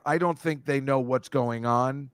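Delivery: ordinary delivery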